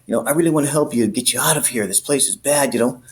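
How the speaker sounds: high, overly chummy cop voice, fast on the sales pitch; butter him up like an old buddy; fake-friendly cop. Cop sweet-talking an inmate at the jail, high chummy voice. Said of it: "It's like we're old buddies".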